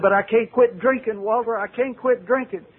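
begging to be understood; desperate plea. Retold or imitated. Pleading he cannot stop drinking.

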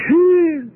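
high, loud whistle of disbelief; loud whew. Acted. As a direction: blow out a sharp, amazed phew. Reacting to Martha's near-scolding of Jesus.

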